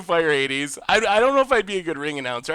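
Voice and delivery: ordinary delivery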